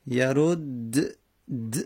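یرد د with both dals vowelless; sukun on both dals. Shown as a demonstration. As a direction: end یرد د on two separate bare d sounds, no vowel. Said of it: "دال اول ساکن بود، دال دوم دیگه ساکن کردیم".

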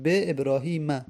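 ابراهیم ending in a sounded "-ma"; fatha ending. Shown as a demonstration. Teacher showing students the exception, fatha ending. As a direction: finish ابراهیم on an open a after the m.

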